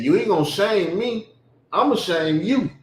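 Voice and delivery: lightly voicing his mama's stern warning; mama's scolding. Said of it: "That's how she talked".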